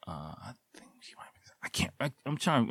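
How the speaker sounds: breathy whisper, muttering to himself; whispered aside. Real, not acted. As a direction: drop to a whisper while trying to remember. Struggling to recall, aside.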